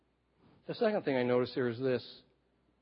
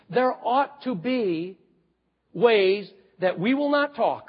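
rising, loud preacher's cadence building; fiery preaching; 9 semitones higher, 6 dB louder than usual. Acted. Preacher driving home a point to the congregation, loud and rising. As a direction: hammer each line like a revival preacher.

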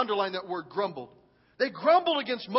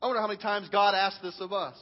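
ordinary talk

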